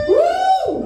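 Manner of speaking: shrieking celebratory woo; high cheer. Real, not acted. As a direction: whoop way up high. Cheering herself on.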